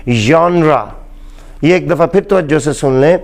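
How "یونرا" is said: teacher's model of یونرا; correct pronunciation. Shown as a demonstration. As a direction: say یونرا slowly and clearly, zh as in vision. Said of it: "نہیں ہے بلکہ".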